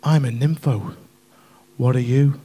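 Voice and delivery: big loud "ooh", then low and suggestive; imitated Debs's voice. Debs propositions Colin over the phone, hammed-up low come-on. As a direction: put on a low-voiced seductive caller. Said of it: "She went".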